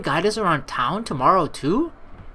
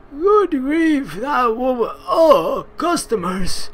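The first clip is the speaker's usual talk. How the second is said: put-on higher, whiny old man sigh; exasperated old man; 7 semitones higher, 5 dB louder, slower than usual. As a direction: groan about her behaviour.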